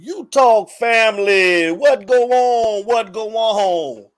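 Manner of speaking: slow, stretched-out show-opening holler; drawn-out greeting. Real, not acted. Host hailing the show's family, drawn-out greeting. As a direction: draw every word out long.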